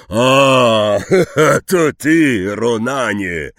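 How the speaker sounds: loud relieved laugh of recognition. A narrator's reading. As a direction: let out the tension on seeing a friend. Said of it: "powiedział z ulgą Hagrid".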